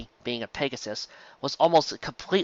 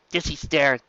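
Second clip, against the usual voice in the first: ordinary delivery, nothing marked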